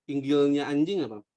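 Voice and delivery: ordinary talk, nothing marked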